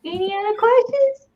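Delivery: high put-on character voice; Sam. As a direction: chirp it high as the pretend fly. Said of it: "That's exactly what that voice is".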